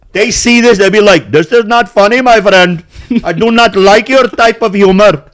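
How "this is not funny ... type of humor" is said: put-on higher, louder Indian accent; exaggerated Indian accent impression. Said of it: "this is my fourth Indian different accent".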